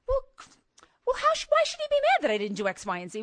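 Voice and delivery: indignant outburst. Retold or imitated. Wife's inner voice getting worked up over her husband, high and fast. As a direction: spiral into an indignant rant.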